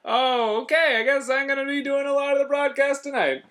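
hammed-up booth partner calling out; Pat Tabler. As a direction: holler it in a strained high comic voice. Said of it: "me and Pat Tabler calling a game".

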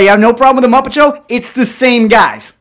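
loud, high, exasperated argument; indignant kid protest. Retold or imitated. Nine-year-old arguing with dad over puppets, indignant protest. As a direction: argue it like an outraged child, stretching the end.

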